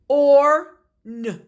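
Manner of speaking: Or n said in two loud parts; sounding it out. Shown as a demonstration. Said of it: "what's it gonna say?".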